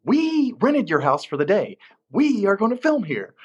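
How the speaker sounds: mock-forceful retort, high and emphatic; taking charge. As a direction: imitate a firm production manager, pitched high. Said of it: "I didn't take charge like she did".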